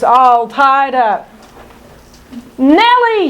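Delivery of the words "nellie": high, calling out to his friend; prince's shout. Acted. Captive prince calls out to Nell, high shout. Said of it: "he shouts".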